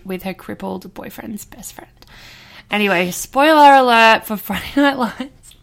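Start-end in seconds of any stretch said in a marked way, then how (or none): 0.0-1.9 whispering. Real, not acted. Spoiler aside.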